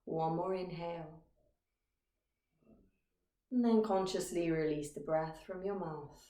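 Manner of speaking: calm, measured meditation guide with long pauses; guided meditation. Acted. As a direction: guide listeners gently into stillness, phrase by phrase. Leads a meditation.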